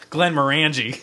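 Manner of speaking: Glenmorangie in a Philadelphia accent, rhymed with "angie". Shown as a demonstration. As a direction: say Glenmorangie the Philly way, flat A in "-rangie". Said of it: "if you're from Philadelphia, you would pronounce it".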